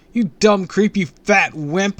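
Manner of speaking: loud high taunt, slowing on the last words; shouted insults. A narrator's reading. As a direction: yell the insults to get his attention. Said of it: "I shouted".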